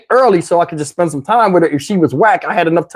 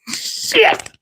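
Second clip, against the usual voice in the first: very high, soft squeak of shock; stunned reaction; 5 dB quieter than usual. Acted. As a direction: let the curse escape in falsetto. Stunned reaction to her plea.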